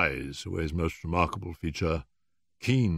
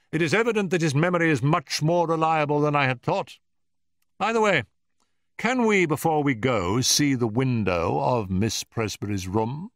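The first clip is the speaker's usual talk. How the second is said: high, louder, animated detective; decisive Holmes; 9 semitones higher, 5 dB louder than usual. A narrator's reading. Holmes asks assistant to show the window, briskly decisive. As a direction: think aloud, then make a polite request.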